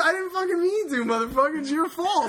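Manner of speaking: high exasperated protest, half laughing; mock indignation. Player arguing back at the game's warning, mock-indignant. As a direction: protest in hammed-up outrage.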